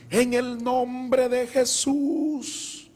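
slow, high, drawn-out prayer; slow praying voice. Retold or imitated. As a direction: stretch each word like a slow old woman praying. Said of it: "Hablaba muy despacio".

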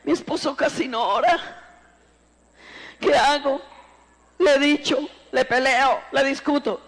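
high-pitched mock lament of a wife; hammed-up high, plaintive fretting; complaining church sister. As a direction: imitate a helpless wife seeking advice. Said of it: "Aquellas hermanas que dicen".

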